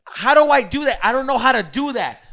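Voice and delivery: loud, high reenacted protest; exasperated. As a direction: act out losing patience with a helper. Acted quarrel, frustrated with helper.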